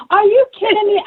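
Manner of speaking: high, loud disbelief; overjoyed, can't believe it; amazed. Retold or imitated. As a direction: near squeal of are you kidding me. Overwhelmed retelling, high and loud.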